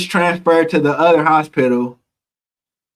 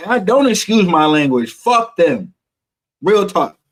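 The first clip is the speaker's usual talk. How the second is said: normal talking voice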